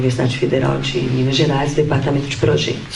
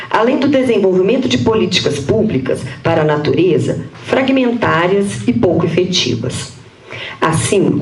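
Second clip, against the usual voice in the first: higher-pitched, measured read-aloud voice; formal paper reading; 6 semitones higher than usual. Real, not acted. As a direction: read out a prepared academic text. Reads her prepared paper aloud. (leitura formal de texto acadêmico)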